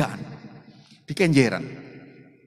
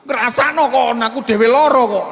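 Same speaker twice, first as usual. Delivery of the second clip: mock complaint; 5 semitones higher, 8 dB louder than usual. Imagined Jesus complaining.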